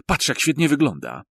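high, thrilled admiration; delighted. Acted. Mike admiring the new president on TV, high and delighted. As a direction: gush over how good he looks. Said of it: "Zachwycił się".

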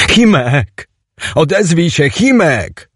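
high, pleading cries; desperate calling. Acted. Teoś cries out to motionless Chimek, high and desperate. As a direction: beg an unresponsive friend to answer. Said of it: "Mogłem tak sobie pokrzykiwać".

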